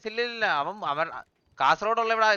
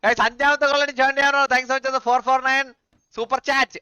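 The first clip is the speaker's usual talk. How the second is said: loud, high super chat thanks; big, raised announcer-style thank-you; streamer shout-out; 7 semitones higher, 8 dB louder, slower than usual. Real, not acted. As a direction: call out the donor at full volume.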